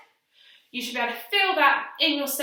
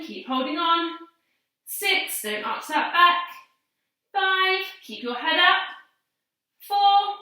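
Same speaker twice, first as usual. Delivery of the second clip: out of breath; 4 semitones higher, slower than usual. Real, not acted. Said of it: "I have to talk while I'm doing it".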